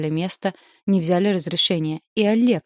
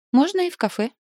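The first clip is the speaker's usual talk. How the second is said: much higher, light, easy agreement; 10 semitones higher than usual. A narrator's reading. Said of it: "легко согласилась Наталья".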